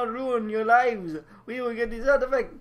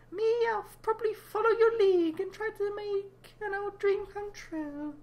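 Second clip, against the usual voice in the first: squeaky character voice; 13 semitones higher, 4 dB quieter than usual. Acted. High character voice.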